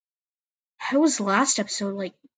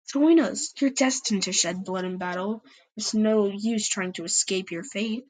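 ordinary delivery, nothing marked

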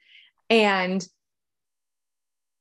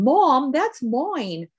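put-on slow, higher child's British accent; 5 semitones higher, much slower than usual. Mother imitating her daughter, slow and higher, in a British accent. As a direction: stretch "Mom, that's mine." out slowly in a child's British accent. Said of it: "with her British accent".

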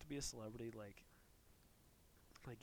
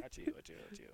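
quick breathy run of sneezes; sneezing; 8 semitones higher, 3 dB quieter, much faster than usual. Retold or imitated. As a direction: fake a string of four sneezes.

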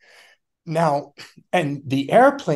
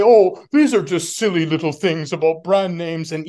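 mock dismissive; 4 semitones higher, 5 dB louder than usual. Mocking skeptics dismissing the topic.

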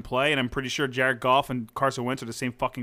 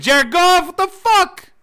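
high, loud furious yell; 14 semitones higher, 8 dB louder, much slower than usual. Real, not acted. Fuming at Jared Goff's bad game, yelling into the microphone. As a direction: yell it into the mic, heated. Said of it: "I'm, like, yelling into the microphone".

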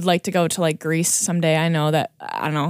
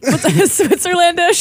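higher, louder, slow through laughter; laughing; 11 semitones higher, 5 dB louder than usual. Real, not acted. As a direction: crack up while saying it. Laughing at own silly word.

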